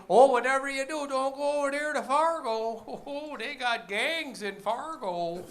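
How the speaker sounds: high North Dakota warning, slowing at the end; mock North Dakota girl warning about Fargo; hammed-up North Dakota accent. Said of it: "And she warned me".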